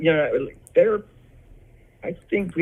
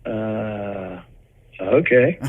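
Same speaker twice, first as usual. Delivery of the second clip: unimpressed; 2 semitones lower, much slower than usual. Retold or imitated. Brother meeting a singer he doesn't know, imitated unimpressed. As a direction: mimic someone with no idea who this is.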